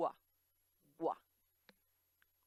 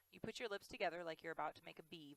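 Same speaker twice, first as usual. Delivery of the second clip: ordinary talk, nothing marked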